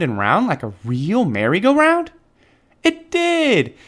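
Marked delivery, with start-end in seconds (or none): 2.8-3.7 high, thrilled exclamation; delighted. A narrator's reading. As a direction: it did, with glee. Excited narration.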